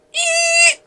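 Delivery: very high sustained squeal; imitated tire screech. A narrator's reading. Car drives off from zombies, imitated tire screech. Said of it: "Tires screech".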